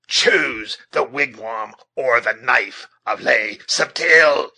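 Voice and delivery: slow, loud, threatening command; forceful ultimatum. A narrator's reading. Huron chief with drawn knife, demanding forcefully that she choose. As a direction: deliver the threat loudly, building to the end.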